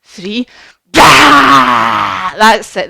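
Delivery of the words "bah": loud, long open-throated roar; full-volume pretend lion roar; big lion roar. Shown as a demonstration. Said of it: "the biggest roar that you can do".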